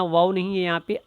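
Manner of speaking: ordinary talk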